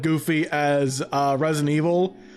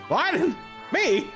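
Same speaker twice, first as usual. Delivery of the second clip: high surprised cocky yelp; Jet the Hawk voice; 10 semitones higher than usual. Acted. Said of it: "Jet the Hawk voice for Boyd".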